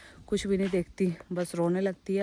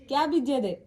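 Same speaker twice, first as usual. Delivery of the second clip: loud repeated call across the street; calling out again; 6 semitones higher, 4 dB louder than usual. Real, not acted. Asking Tai across the street what she sows, calling out. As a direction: call out the question to her once more.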